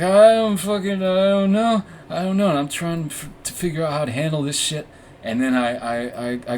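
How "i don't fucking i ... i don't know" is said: high loud panicked stammer. Retold or imitated. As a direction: blurt it out in panic. Speaker panicking to dad.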